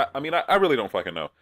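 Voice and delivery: ordinary talk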